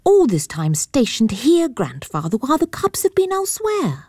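put-on high, girlish, rising in dismay; a young lady lamenting the wasted wait; disappointed girl. Girl complains to grandfather.

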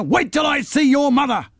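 put-on high shouted threat at a boy; angry shouted threat. A narrator's reading. Neighbour shouts a threat at the runaway boy. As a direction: yell it with a shaken fist. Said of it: "waving his fist in the air".

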